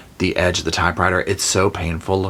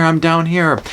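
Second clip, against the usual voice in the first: mock yell; 9 semitones higher, 4 dB louder than usual. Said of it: "he hears Paul yelling".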